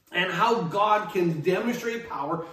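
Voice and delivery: usual speaking voice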